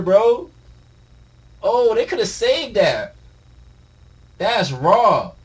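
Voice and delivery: high, loud amazed ranting; hyped disbelief. Real, not acted. Reader raving about the chapter reveal, excited. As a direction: rave about the panel.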